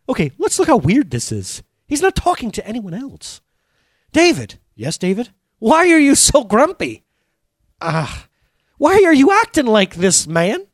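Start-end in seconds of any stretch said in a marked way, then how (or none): 4.1-10.7 high-pitched self-talk as two Davids; David scolding himself. Acted. Preacher acts out David arguing with himself, high and exasperated. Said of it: "He tells himself".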